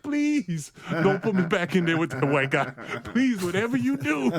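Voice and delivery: high-pitched, comic begging; mock pleading. Benched rookie silently begging the coach not to play him, mock pleading. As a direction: plead desperately for laughs.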